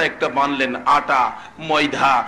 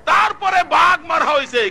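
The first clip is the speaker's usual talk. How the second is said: high, forceful proclamation; ringing sermon cry; preaching shout; 11 semitones higher, 3 dB louder than usual. Real, not acted. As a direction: shout the line out high to the crowd. Shouted, then repeated low.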